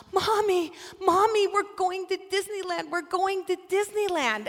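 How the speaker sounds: put-on high, loud, thrilled child calling to mom; ecstatic child. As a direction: shout the news like a delighted little girl.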